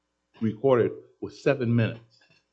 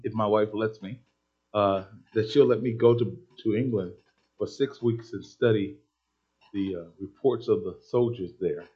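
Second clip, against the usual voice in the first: ordinary delivery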